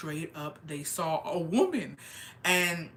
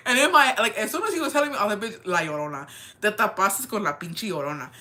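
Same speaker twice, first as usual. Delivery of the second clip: loud, raised-pitch reaction; retold outburst; 3 semitones higher, 7 dB louder than usual. Reacting to him telling her about La Llorona, excited outburst. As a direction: burst in loudly with your retold reaction.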